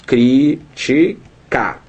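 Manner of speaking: drawn out, each syllable separated. Shown as a demonstration. Teacher separating syllables for the class, syllable by syllable. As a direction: split it slowly into syllables. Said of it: "se eu separar as sílabas".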